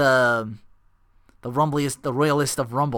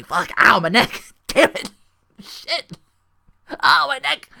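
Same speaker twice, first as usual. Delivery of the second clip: mock pained old man; 10 semitones higher, 4 dB louder than usual.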